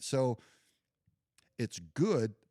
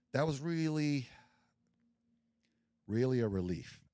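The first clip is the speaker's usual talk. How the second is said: usual speaking voice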